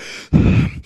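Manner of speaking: heavy tired sigh; exaggerated breathing out after venting; winded exhale. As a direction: puff out like a spent runner. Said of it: "breathing like you just ran a marathon".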